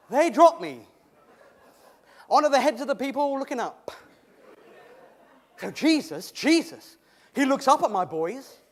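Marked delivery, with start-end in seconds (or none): none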